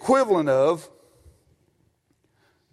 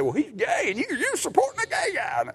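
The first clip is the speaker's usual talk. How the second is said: mocking taunt; 9 semitones higher, 5 dB quieter than usual. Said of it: "making fun of my T-shirt".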